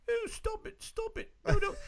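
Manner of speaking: shrill mocking falsetto protest; high whiny girl voice. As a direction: squeal it like a prissy girl. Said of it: "This is how it's gonna sound".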